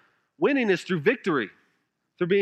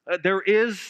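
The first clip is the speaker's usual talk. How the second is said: ordinary talk, nothing marked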